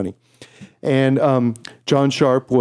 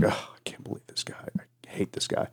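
whispered aside of disgust; 15 dB quieter than usual. Retold or imitated. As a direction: hiss it under your breath. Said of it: "and I'm like".

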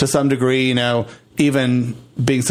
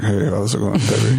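deep casual guy; 5 semitones lower than usual. Retold or imitated. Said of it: "not talking like that".

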